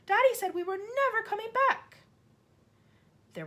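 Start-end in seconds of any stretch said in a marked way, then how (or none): 0.1-2.0 put-on high distraught girl; upset child. A narrator's reading. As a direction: cry it out. Matilda voiced.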